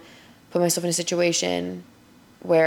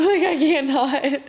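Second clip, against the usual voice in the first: yawning; 8 semitones higher, 4 dB louder, slower than usual. Real, not acted. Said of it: "I keep yawning".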